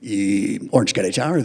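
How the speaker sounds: low, loud, puffed-up pilot radio voice; deep booming captain on the radio; proud airline captain. Retold or imitated. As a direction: announce yourself like the proudest captain alive. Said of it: "very proud airline captain voice".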